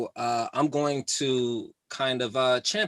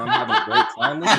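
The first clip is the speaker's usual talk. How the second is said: laughing; 3 semitones higher, 5 dB louder, faster than usual. Real, not acted. Laughing through sarcasm.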